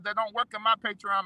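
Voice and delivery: ordinary talk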